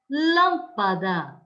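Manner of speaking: lâmpada slow, with LÂM punched; stress on first syllable. Shown as a demonstration. Teacher gives an antepenultimate example to pupils, lâm stressed in lâmpada. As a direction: hit lâm in lâmpada and fall away. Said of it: "a sílaba tônica é a antepenúltima".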